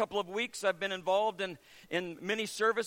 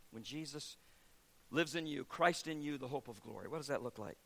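quiet reflection; 6 semitones lower, 8 dB quieter than usual. Acted. Preaching soft lowered.

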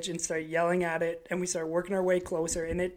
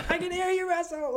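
high strained radio shout; 12 semitones higher than usual. Retold or imitated. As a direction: yell it up to the pilot.

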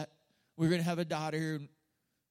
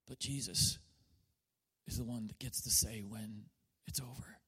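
much softer, lower, sinking to a whisper; hushed storytelling; 10 semitones lower, 7 dB quieter, faster than usual. Acted. Preacher telling Jesus raising the girl, hushed. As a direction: draw them in with a near whisper.

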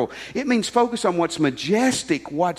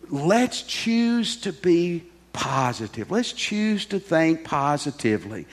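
slow emphatic urging; slower than usual. Real, not acted. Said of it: "urging congregation, slow emphatic".

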